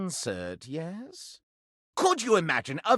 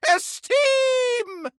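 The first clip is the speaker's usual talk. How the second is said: much higher, one long falling cry; rapturous cry. Acted. Candelabra praising the visitor, delighted cry of Esteem. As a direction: burst out with delight at the word.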